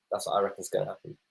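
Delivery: ordinary delivery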